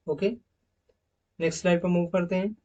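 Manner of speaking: usual speaking voice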